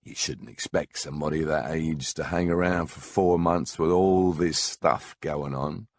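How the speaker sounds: ordinary talk, nothing marked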